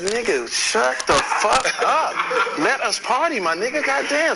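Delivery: high, loud, drawn-out outburst; retold angry shout. Partygoer telling off the young artist, hammed-up angry shout.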